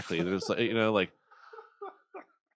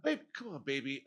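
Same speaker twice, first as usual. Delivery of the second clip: much softer coaxing plea; soft pleading; 2 semitones higher, 12 dB quieter than usual. Acted. Pleading partner overheard.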